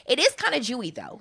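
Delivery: ordinary talk, nothing marked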